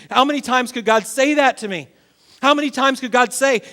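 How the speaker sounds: ordinary talk, nothing marked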